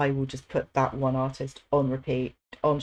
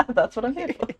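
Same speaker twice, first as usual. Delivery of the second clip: laughing; 12 semitones higher, 9 dB louder than usual. Real, not acted. Laughing at own joke.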